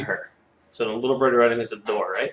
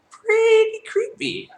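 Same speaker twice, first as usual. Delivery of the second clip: very high, drawn-out squeak of unease; creeped-out aside; 6 dB louder, much slower than usual. Real, not acted. Her aside on the creepy line.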